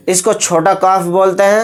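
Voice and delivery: ordinary delivery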